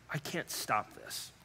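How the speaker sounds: slow, breathy whisper of despair; hushed and slow, pleading with God; desperate whisper. Retold or imitated. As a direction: whisper it like a broken confession. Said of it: "I said".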